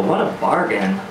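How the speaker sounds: usual speaking voice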